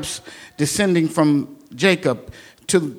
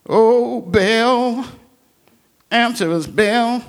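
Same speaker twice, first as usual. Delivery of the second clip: lightly raised pleading call to Baal; half-hearted chant of the crowd; mild mock shout; 5 semitones higher, slower than usual. Prophets of Baal calling to their god, mild mock shout. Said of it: "they shouted".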